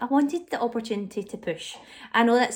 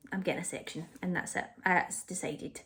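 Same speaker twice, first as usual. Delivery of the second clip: much softer, low resigned inner thought; 3 semitones lower, 11 dB quieter than usual. Retold or imitated. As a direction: say it to yourself, almost under your breath. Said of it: "I was like, in my head".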